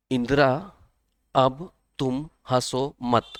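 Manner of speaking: drawn out "अब तुम हंसो मत" spoken slowly; slow mnemonic. Shown as a demonstration. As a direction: stretch each word of "अब तुम हंसो मत". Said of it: "इसको ऐसे भी याद कर सकते हैं". ("अब तुम हंसो मत" को बहुत धीरे, खींचकर बोलें)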